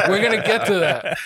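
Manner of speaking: laughing through the words; real laughter breaking into the line. Real, not acted. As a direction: still cracking up as you say it. Host laughs at pandemic question.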